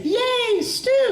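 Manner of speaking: put-on very high childlike cheer; high, gleeful little cheer. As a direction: squeal it with delight. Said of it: "She was gonna say".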